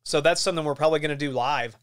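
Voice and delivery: ordinary delivery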